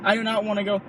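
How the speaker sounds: ordinary talk, nothing marked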